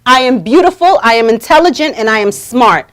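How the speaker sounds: loud, measured self-declaration; emphatic affirmation. Shown as a demonstration. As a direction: proclaim each phrase firmly and loudly. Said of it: "say it like this".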